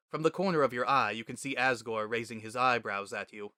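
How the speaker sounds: ordinary delivery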